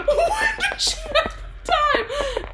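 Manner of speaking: very high, slow squeal through laughter; helpless laughing. Real, not acted. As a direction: wail the question while cracking up. Repeats question while cracking up.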